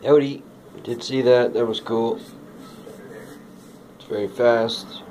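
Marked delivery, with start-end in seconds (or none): none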